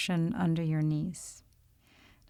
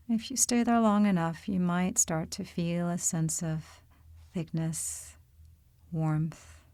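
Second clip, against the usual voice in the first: calm, unhurried meditation voice with long pauses; guided meditation. Real, not acted. Yoga teacher leading listeners into body awareness, calm guided-meditation delivery. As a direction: guide them gently through the body, leaving space between phrases.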